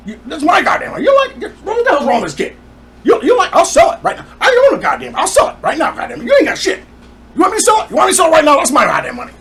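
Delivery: high, loud, ranting impression; imitating raging dad. Son imitating dad threatening to sell the building, raging rant. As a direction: hammer it out as dad's hammed-up tirade. Acted quarrel, dad pointing in face.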